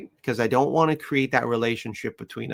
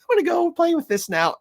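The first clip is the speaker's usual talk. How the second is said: voicing the puppy; 4 dB louder than usual. Voices puppy's thoughts.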